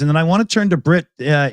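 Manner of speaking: ordinary talk, nothing marked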